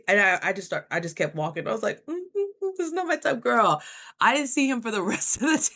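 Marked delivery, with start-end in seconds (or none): 2.1-3.4 mock dismissive. Fake dismissive excuse.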